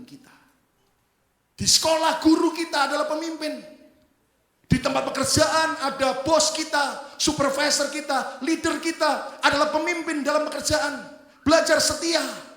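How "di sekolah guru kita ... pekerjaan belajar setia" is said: loud, high preacher's exhortation; full-force preaching. Real, not acted. Preacher urging the congregation not to be spoiled, full-force preaching. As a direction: drive it home to the congregation at a shout.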